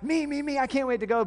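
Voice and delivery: put-on high eager kid voices. Kids at the hilltop, eagerly calling out. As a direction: kids clamoring to go first. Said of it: "not talking about the who's gonna go first".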